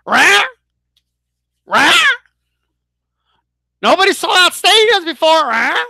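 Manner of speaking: high, loud squawking parrot mimic; parroting crowd. Mocking fans who repeat hype, squawked parrot-style. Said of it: "people are gonna just repeat it".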